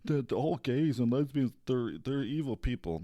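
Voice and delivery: lightly mock the accuser; put-on voice of an imagined critic; imitating critics. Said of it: "people are gonna say".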